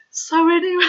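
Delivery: ordinary talk, nothing marked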